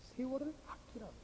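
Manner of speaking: soft but lifted in pitch; soft repeated reminder. Real, not acted. Preacher repeats the verse to the congregation, softly insistent. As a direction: repeat the verse quietly, without dropping the pitch.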